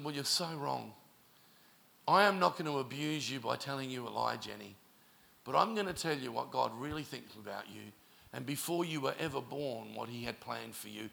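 low, soft pastoral comfort; retold gentle reassurance. Retells comforting the distressed girl.

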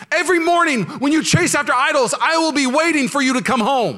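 high, loud, anguished pleading; God pleading aloud. Acted. Preacher voicing God's torn heart, loud and pleading.

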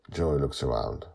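usual speaking voice

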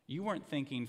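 normal talking voice

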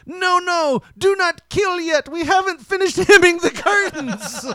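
hammed-up frantic puppet protest; squeaky puppet panic. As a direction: plead like a tiny calf puppet. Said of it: "And he's like".

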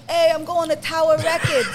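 mock your old Brooklyn voice from the video; thick Brooklyn accent. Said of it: "I don't wanna sound like".

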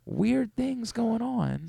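soft, slow impression, pitched high; Brian Windhorst. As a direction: drag it out softly, high and mysterious. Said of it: "it's soft for sure".

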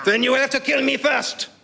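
high, heroic French declaration; French accent. Acted. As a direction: defy him in grand French tones. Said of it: "in outrageous French accent".

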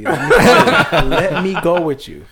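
high, laughing, overeager; his eager retold reply, laughing through it; laughing eager plea. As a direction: beg to tag along, cracking up.

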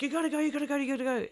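high-pitched panicky urging; urgent. Retold or imitated. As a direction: pitch it up high and hurry them off. Fleeing cops, urging driver.

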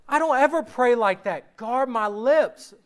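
normal talking voice

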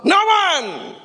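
high ringing shout. Real, not acted. Preacher shouting back at the congregation. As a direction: throw it to the back row. Whispered echo then shout.

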